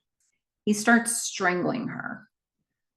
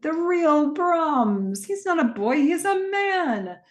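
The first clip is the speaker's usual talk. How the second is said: Malcolm's panicked exclamation; 6 semitones higher, much slower than usual. Retold or imitated. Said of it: "Malcolm's like".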